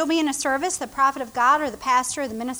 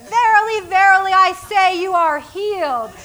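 much higher and louder, grand KJV voice; pompous prophet; 5 semitones higher, 7 dB louder than usual. Pretend. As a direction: ham up a solemn prophet. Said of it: "This is the way God's gotta do it".

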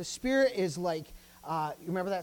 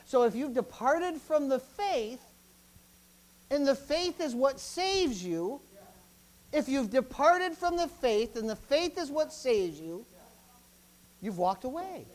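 slow, raised, deliberate pulpit voice; measured, insistent preacher; emphatic preaching; 5 semitones higher, 4 dB louder, slower than usual. Real, not acted. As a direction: press each phrase home slowly. Preaches the argument slowly.

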